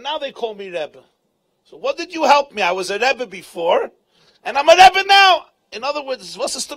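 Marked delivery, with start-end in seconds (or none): 1.6-5.5 much higher and louder, indignant protest; indignant retort. Retold or imitated. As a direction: fling it back at him, outraged. Rish Lakish retorting to Rabbi Yochanan.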